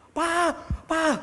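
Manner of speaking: high, loud call of Pak, Pak; son shouting. Retold or imitated. Sick son shouting for his father, high and loud. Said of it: "dia teriak".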